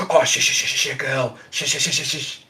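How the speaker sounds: soft, hushed animal calming; soothing shushing. Acted. Handler calming the freaked-out emu, soft shushing. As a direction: shush a frantic creature gently and quietly. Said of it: "come in and go".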